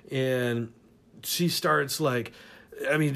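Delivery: ordinary talk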